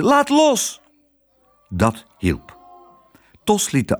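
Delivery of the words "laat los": put-on very high, urgent girl's cry; calling out. Girl telling her father to release the clerk, high urgent call. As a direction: order it in a high child's cry. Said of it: "riep ze".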